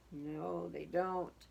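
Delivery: plain reading voice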